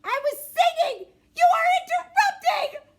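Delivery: very high, loud, outraged yell; a kid howling back at mom; furious tantrum shout. Acted. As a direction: scream it like a kid mid-tantrum. Tantrum after being told off.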